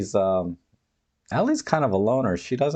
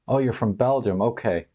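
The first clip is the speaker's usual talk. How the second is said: ordinary talk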